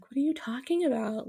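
high, mock-innocent voice of the girl; a mock-innocent girl, pitched high; playing dumb. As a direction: imitate her pretending not to know. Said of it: "acting all stupid".

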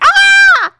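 loud high-pitched startled scream; full-volume mock shriek; acted scream. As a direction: scream in comic shock at a stranger. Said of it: "so she screamed".

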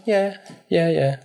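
Rose's sugary agreeing, imitated high; mock sweet girlfriend. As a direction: play the fake-nice girlfriend. Rose voiced agreeing sweetly.

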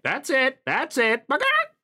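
high egging-on chicken with a squawk; cheering chicken voice. Acted. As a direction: cheer him on like a tiny hen. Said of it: "he's like".

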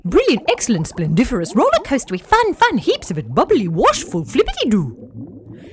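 bubbling over with fun; squeaky excited fish voices; excited fish chorus. Acted. Fish all reply excitedly.

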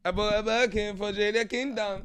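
high, loud mock rapper boast; hammed-up bragging rapper voice; imitating a rapper. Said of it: "man said".

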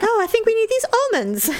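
high-pitched, put-on casual excitement; feigned interest. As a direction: pretend sudden interest to cover embarrassment. Feigning interest to hide from couple.

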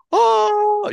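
very high, loud delighted gasp; hammed-up thrill at opening a present; gushing gift receiver. Co-host imitating friend receiving a gift, excited. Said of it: "being a good gift receiver".

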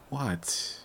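normal talking voice

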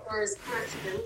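muted, distant voice on the call. Real, not acted. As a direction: sounds muffled and far away. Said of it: "you sound quite muted".

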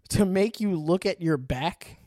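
slow, laughing through it; laughing reread. Acted. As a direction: giggle while rereading the line. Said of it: "laughs, rereads stumbled phrase".

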